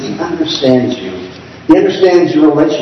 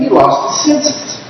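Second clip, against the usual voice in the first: scoffing relatives; 9 semitones higher, slower than usual. Retold or imitated. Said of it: "For they were saying".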